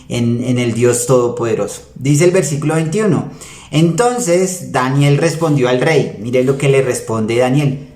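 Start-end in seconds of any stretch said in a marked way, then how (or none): none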